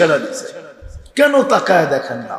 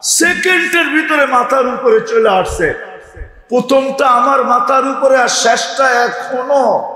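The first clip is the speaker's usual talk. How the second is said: raised, fervent sermon climax; impassioned preaching; 6 semitones higher than usual. Acted. Preacher describing the horsemen appearing to the crowd, fervent. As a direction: build the vision up with fire. Preacher at full force, shout.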